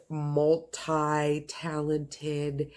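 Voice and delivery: normal talking voice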